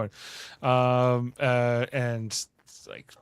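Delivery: normal talking voice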